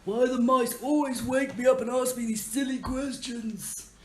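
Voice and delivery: a grumpy tree spirit just woken up; sleepy tree voice. Acted. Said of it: "he says".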